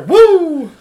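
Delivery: high, loud Ric Flair woo. Retold or imitated. Cheering on the host, a loud Ric Flair woo. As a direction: belt out a wrestler's woo. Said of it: "Ric Flair".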